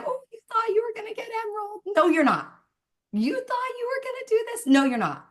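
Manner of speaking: sing-song high teasing, flat no's; a cruel tease dangling a prize; mocking taunt. Voicing a false God taunting the team, high mocking sing-song.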